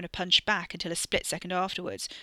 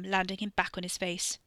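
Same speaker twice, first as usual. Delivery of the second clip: ordinary delivery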